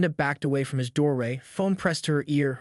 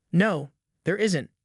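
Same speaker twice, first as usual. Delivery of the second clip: ordinary delivery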